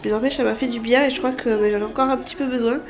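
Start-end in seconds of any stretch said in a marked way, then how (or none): none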